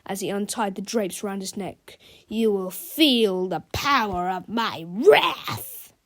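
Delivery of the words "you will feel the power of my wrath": slow, menacing villain threat. Acted. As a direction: threaten him slowly. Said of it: "said Big Melvin".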